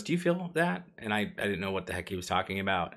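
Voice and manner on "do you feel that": paramedic's question. Retold or imitated. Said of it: "at one point he goes".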